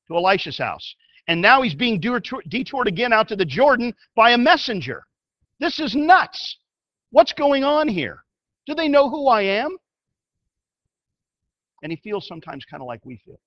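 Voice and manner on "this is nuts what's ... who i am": outraged. Retold or imitated. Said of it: "the nuttiest request he's ever heard".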